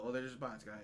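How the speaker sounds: low, soft self-soothing mumble; coping self-reassurance. Retold or imitated. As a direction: talk yourself down quietly. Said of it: "that's my new cope".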